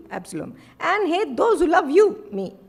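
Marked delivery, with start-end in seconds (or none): 0.8-2.2 loud and higher, accusing; stern rebuke. Acted. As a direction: throw the accusation at him. Joab rebukes the king.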